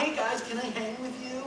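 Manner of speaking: high, needy self-parody; mock-eager pleading. Hapless newcomer asking other artists to join, mock-eager. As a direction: pitch it up like a hopeful tagalong.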